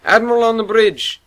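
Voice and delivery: loud, high formal call; crisp bridge announcement; announcing call. Acted. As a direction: announce the admiral's arrival. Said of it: "Vabes called".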